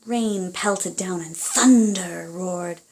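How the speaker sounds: ordinary delivery, nothing marked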